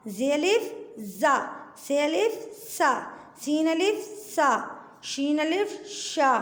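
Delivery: each letter pair read slowly with pauses; clear, slow teacher drill; sounding out letters. Shown as a demonstration. Teacher keeps reading the letter pairs, slow and clear. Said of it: "पढ़ के भी बता देती हूँ".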